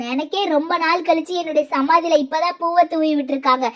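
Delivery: normal talking voice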